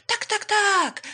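high, loud, excited chirp; shrieky excited swallow; calling out. A narrator's reading. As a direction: cry yes eagerly, right away. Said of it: "zawołała jaskółka".

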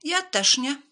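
high, loud cat refusal; loud, high storybook cat; called-out refusal. A narrator's reading. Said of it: "zawołał biały kot".

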